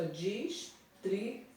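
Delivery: "distribuir" said slowly as dis-tri-bu-ir; separando as sílabas. Shown as a demonstration. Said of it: "separando a sílaba aqui". ("distribuir" baixinho, pausado, sílaba por sílaba)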